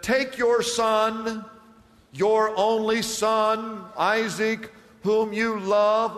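very slow, weighted phrases with pauses; solemn command. Acted. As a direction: let each phrase of God's command land separately. God's solemn command, read for emphasis.

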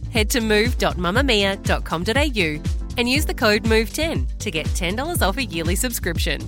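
ordinary delivery

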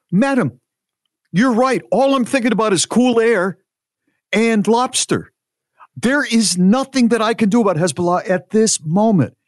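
high-pitched, emphatic mock indignation; hammed-up, high-voiced self-defence; exasperated retort. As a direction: argue back, every phrase set apart. Acted quarrel, his retort to her.

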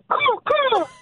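very high screeching caw caw; bird caw call. Shown as a demonstration. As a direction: squawk it out like a crow. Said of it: "can you do the sound one more time".

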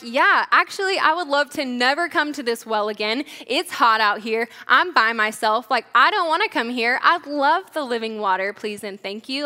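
mock eagerness, punched out then dropping away; almost mocking. Woman at the well answering Jesus's offer, almost mocking. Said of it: "almost mocking".

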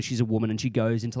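usual speaking voice

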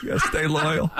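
laughing. Real, not acted. Joking about Mark's loyalty.

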